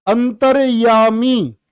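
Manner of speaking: अंतर्यामी stretched syllable by syllable; slow demo. Shown as a demonstration. Teacher drawing out अंतर्यामी for the class. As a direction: sound out अंतर्यामी very slowly. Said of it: "आपसे पूछा जाता है".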